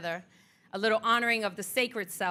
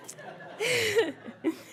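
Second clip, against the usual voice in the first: high delighted laugh; laughter; 10 semitones higher than usual. Real, not acted. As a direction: laugh with joy. Laugh alone on line.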